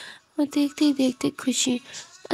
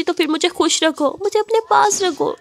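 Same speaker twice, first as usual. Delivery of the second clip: tearful plea; 5 semitones higher, 5 dB louder than usual. Acted. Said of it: "Ananya बोलती".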